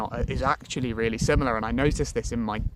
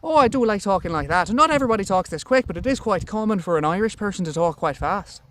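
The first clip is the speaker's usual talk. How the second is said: quick, higher, louder Irish lilt; Irish accent; 6 semitones higher, 4 dB louder than usual. Shown as a demonstration. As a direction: put on a brisk, high Irish accent. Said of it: "speech is rather fast, so, like, Irish".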